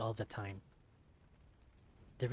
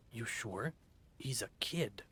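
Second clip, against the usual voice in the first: henchman voice; 4 dB quieter, slower than usual. Acted. Henchman doubtful.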